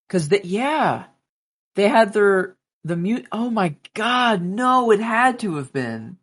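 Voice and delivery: startled realisation, pitch climbing; real dismay. Real, not acted. Friend gasps high at the timeline realisation. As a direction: voice jumps up high in alarm.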